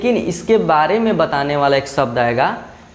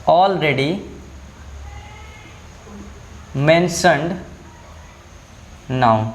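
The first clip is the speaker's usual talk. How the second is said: word by word, long pauses between; dictation; 4 dB quieter, much slower than usual. Shown as a demonstration. Teacher dictates a definition for children to write down, word-by-word dictation. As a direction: dictate slowly for note-taking.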